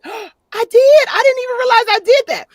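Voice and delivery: high, loud retold surprise; astonished disbelief. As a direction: squeal it in hammed-up amazement. Reenacts her surprise at being told.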